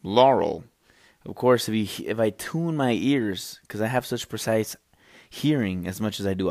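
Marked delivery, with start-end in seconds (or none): 0.0-0.6 flat, clipped machine Laurel; computer voice imitation. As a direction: do the recording's voice. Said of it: "he's going".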